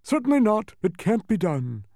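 high, loud emphatic bug; high-pitched pompous agreement; echoing humbug. A narrator's reading. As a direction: parrot the refusal firmly. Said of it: "repeated the humbug".

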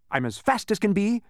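high, eager announcement; announcing. A narrator's reading. Man running round the island, announcing. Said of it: "he announced".

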